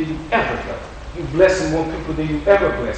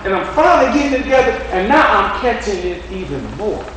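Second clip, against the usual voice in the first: high, ringing preacher's build; full-force preaching; 7 semitones higher than usual. Acted. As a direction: climb it, pressing each "now" home.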